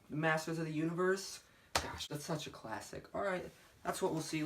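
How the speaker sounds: ordinary talk, nothing marked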